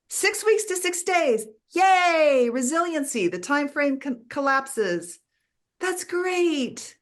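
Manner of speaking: high-pitched, delighted cheer; cheering celebration. Acted. Teacher celebrating progress the ego dismisses, high excited cheer. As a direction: celebrate a small win, voice lifted high, with a big yay.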